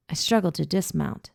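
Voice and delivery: ordinary delivery, nothing marked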